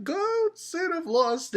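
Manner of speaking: high, drawn-out hammy proclamation; mock boastful poser. A kid posing as a Deadly Sin, high mock boast. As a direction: announce a silly title in a high put-on voice.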